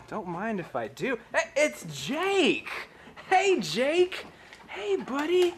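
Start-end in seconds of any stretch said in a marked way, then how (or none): 1.3-5.5 put-on high squeaky baby talk to a dog; squeaky praising a dog. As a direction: coo at the dog in falsetto. Greeting the dog.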